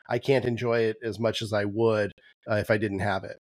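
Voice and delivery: ordinary talk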